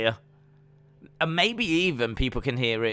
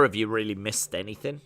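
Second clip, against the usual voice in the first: ordinary talk, nothing marked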